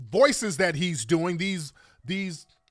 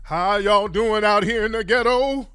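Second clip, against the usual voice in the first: cosplaying outsider; 2 semitones higher, 4 dB louder than usual. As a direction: ham up a stiff outsider trying to fit in. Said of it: "ridiculous ass cosplaying".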